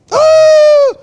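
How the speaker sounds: very high, loud falsetto ooh; ecstatic ooh. Real, not acted. Tasting cornbread like cake, a loud high squeal. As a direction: let out a big squeal of pure delight.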